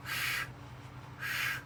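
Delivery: sh said as a soft hushing sh; teaching the dotted S as sh; sh sound. Shown as a demonstration. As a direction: hush out sh, sh. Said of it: "when you have a dot under the S, it's a sh".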